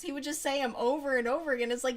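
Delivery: ordinary talk, nothing marked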